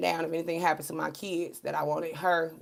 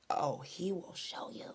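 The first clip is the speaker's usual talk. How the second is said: ominous whisper; 13 dB quieter, slower than usual. Acted. God's answer voiced.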